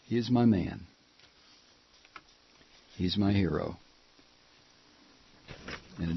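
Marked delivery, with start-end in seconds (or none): none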